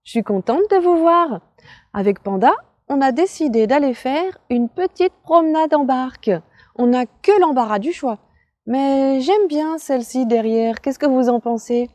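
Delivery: put-on high, loud, bouncy kids' host; bright squeaky sing-song welcome.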